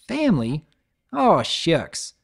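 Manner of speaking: bashful aw shucks; flattered country pony; lightly voiced shy farm mare; Applejack voice.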